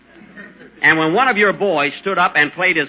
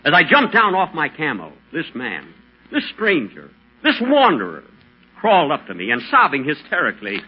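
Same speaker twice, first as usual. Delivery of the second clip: dramatic story narration; 4 semitones lower than usual. Acted. Dramatic desert tale, music.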